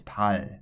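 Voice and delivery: pal with a sound between l and r; Korean liquid demo. Shown as a demonstration. Teacher shows learners the true Korean sound, careful demo. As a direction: say pal with a flap halfway between l and r. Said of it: "mixture between those two".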